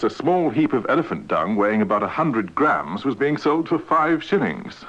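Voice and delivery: usual speaking voice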